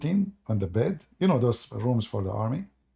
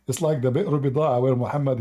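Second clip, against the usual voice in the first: normal talking voice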